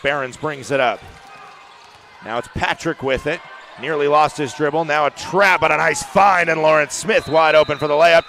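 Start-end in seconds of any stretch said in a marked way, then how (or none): 3.8-8.3 rising to a loud layup call; louder, higher, excited commentator; building play-by-play excitement. Real, not acted. Live call building to basket.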